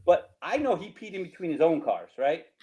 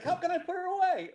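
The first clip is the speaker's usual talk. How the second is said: much higher squeaky mock whine; mocking the kid. Retelling the cuffed kid's excuse, high mocking whine. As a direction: squeak it out like a helpless drunk kid. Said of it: "so it's hilarious 'cause he's like".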